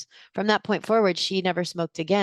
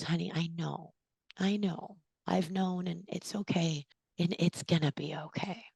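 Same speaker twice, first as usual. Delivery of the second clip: much softer, hushed comforting voice; gentle reassurance; 8 dB quieter than usual. Retold or imitated. Best friend comforting her after a confession, hushed and gentle. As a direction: soothe her like a dear friend. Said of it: "she goes".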